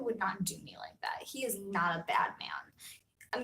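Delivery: hushed whispered aside. Real, not acted. Whispering a secret to viewers.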